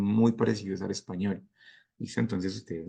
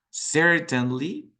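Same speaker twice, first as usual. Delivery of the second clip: "Certainly" said very slowly and higher, rising at the end; drawn-out certainly; 7 semitones higher, 3 dB louder, much slower than usual. Shown as a demonstration. Teacher stretching "Certainly" slowly for students. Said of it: "So I would say no".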